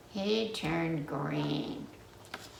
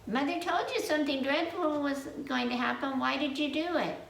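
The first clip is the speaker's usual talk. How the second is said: higher and quick, a lightly voiced tattling sibling; scolding ghost sibling; 7 semitones higher, faster than usual. Ghost siblings scolding their brother, higher and quick. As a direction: tell him off like a bossy big sister, lightly.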